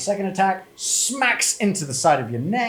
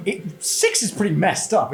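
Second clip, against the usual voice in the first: ordinary talk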